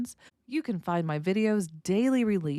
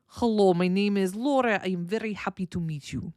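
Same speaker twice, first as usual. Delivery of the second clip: Russian accent. Shown as a demonstration. Teacher showing comic impersonation to learners, caricature Russian accent. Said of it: "I put on my Russian accent".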